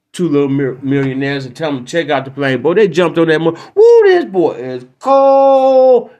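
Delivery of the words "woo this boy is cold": high, loud, stretched-out woo; excited whoop. Acted. Millionaire marveling at new plane, loud high whoop. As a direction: savour it, impressed.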